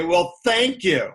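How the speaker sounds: mildly snide retold thanks; a touch of mock gratitude, lightly snide. Annoyed traveler thanks the guru for his mantra, snide. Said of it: "in a snide way".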